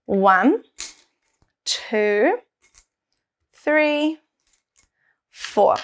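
put-on slow counting aloud for kids; teaching a child to count. Shown as a demonstration. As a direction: count each one out with pauses. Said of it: "You can count them with me".

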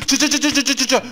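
playful mouth-made gunshots; mouth gunfire. Acted. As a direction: spit out gunfire sounds like a kid playing. Imitating gunfire while shooting.

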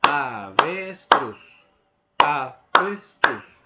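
avestruz beaten out loud and slow, syllable by syllable; syllable rhythm. Shown as a demonstration. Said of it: "O el ritmo de la palabra avestruz".

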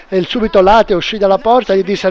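usual speaking voice

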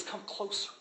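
soft, close, tender call; gentle hushed invitation. Acted. Jesus softly calling people closer. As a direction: pull them in gently. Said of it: "He says".

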